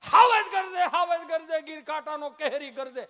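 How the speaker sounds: very loud, high booming poem; old schoolmaster bellowing the poem; thunderous recitation. Retold or imitated. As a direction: roar the verse like a lion.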